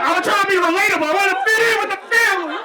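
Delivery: high, loud mock-desperate pleading; shouted protest. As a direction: plead your case at a hammed-up yell. Acted pleading in family quarrel.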